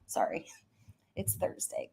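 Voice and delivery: soft breathy whisper after a laugh; whispered aside. Real, not acted. Host whispering an apology to her live viewers.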